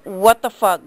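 normal talking voice

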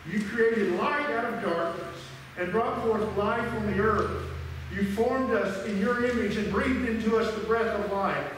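ordinary talk, nothing marked

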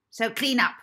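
loud, high, scornful headmistress; stern matron. Acted. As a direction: bark the announcements at the orphans. Miss Addison to Molly.

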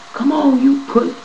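high, heated rant that trails off; snapping. Retold or imitated. At the guards in his cell, retold snapping rant. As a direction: retell himself losing it at the guards. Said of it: "I'm snapping".

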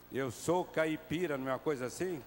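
usual speaking voice

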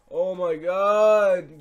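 loud, high, drawn-out cry; exasperated outcry. Real, not acted. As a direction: wail it slowly at full volume. Dismay at another bad pack.

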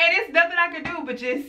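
usual speaking voice

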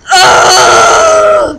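loud deep frustrated cry; frustrated scream. Real, not acted. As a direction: scream in exasperation. Grito de frustración.

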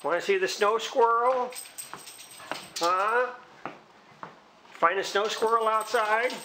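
very high, sing-song voice for the dog; high excited pet talk. Real, not acted. Teasing dog about squirrel.

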